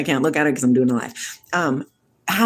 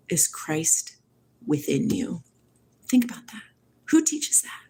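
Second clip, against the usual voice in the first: whisper; 5 dB quieter than usual. Acted. Secret revealed to chat, whispered.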